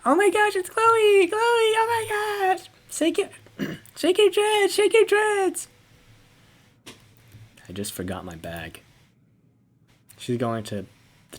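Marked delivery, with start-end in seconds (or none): 0.0-5.7 much higher, louder screaming fan voice; squealing hysterical fan; fangirl shriek. Acted. Screaming fan spotting Chloe.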